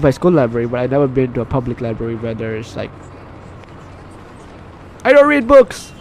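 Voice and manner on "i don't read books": blurted admission. Real, not acted. Blurted confession.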